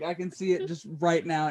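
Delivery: usual speaking voice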